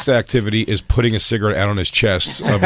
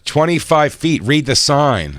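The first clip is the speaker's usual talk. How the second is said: higher, insistent telling-off; mock officious order, pitched up; imitated scolding tone; 7 semitones higher, 4 dB louder, slower than usual. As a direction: mimic an old man repeating the rule. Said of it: "Oh, his tone".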